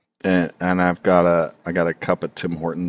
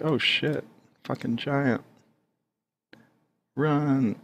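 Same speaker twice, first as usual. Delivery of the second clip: scared guy; 8 semitones higher, 4 dB quieter than usual. Acted. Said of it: "this guy's like".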